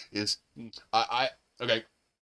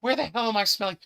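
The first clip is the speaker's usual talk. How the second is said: puzzled high question; 10 semitones higher, faster than usual. Retold or imitated. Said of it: "I was like".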